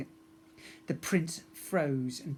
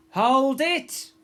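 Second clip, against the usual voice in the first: higher, much louder bellow; 10 semitones higher, 10 dB louder than usual. A narrator's reading. Mum stopping the pirates, loud bellow. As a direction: stop the whole hall with it. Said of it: "she bellowed".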